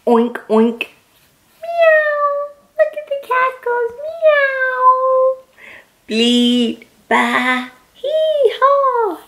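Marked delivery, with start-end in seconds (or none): 1.6-5.4 very high mewing kitty voice; cat meow voice. Shown as a demonstration. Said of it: "make some of these noises". 7.9-9.2 very high hee then high haw; donkey bray. Shown as a demonstration. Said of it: "make some of these noises".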